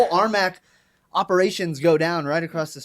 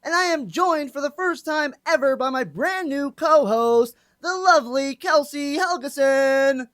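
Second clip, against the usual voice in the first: announcer intro; 6 semitones higher than usual. Acted. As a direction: introduce show and co-host like a ring announcer. Opens the radio show as announcer.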